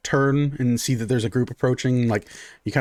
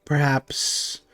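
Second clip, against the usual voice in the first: nosy priestess. Acted. Priestess probes the curse.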